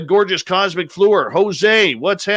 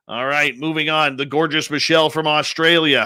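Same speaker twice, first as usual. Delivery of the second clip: normal talking voice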